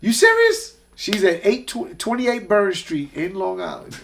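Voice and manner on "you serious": high, loud, thrilled disbelief; disbelieving squeal. Retold or imitated. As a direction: squeal it like you can't believe the news. [name]'s excited disbelief, retold.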